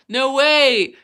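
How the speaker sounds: usual speaking voice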